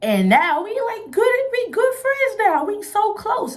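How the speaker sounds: high, delighted gush; thrilled about the new friend; excited. Real, not acted. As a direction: squeal it out happily.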